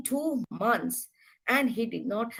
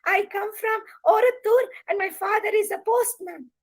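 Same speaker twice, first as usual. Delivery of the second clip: put-on much higher little girl's voice; 10 semitones higher than usual. A narrator's reading. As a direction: chirp it like a small schoolgirl. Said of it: "She stood up and she said".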